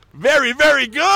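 high, loud slogan delivery; hammed-up grandma reciting an ad slogan; imitating grandma. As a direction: proudly sing the brand's praises as grandma. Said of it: "Grandma, whenever she'd make pasta".

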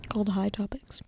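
soft unvoiced whisper. Real, not acted. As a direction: drop to a whisper mid-sentence. Trails off, unvoiced.

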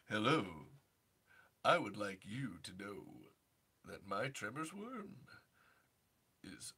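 George Takei impression. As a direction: purr it low like a flirty Takei.

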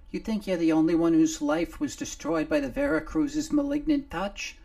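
a little face on a shoulder gulping fresh air; little Nomar. Acted. Nomar freed from under the clothing, high and loud.

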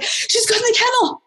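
high, delighted report; excited owner. Retold or imitated. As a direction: voice the thrilled mom. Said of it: "She's like".

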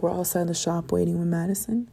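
ordinary delivery

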